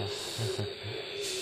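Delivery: soft, low amused laugh; quiet laugh. Real, not acted. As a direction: laugh softly at the nip. Beetle grabs his finger.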